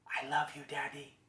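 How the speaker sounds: whispered imitation of a toddler's secret. Daughter whispering in Daddy's ear, imitated whisper. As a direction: whisper it sweetly like a small child. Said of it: "she starts whispering into my ear".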